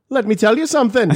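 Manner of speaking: high, loud mock Dre line; an overpitched, hammed-up [name]; [name] impression. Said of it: "try and do an impression".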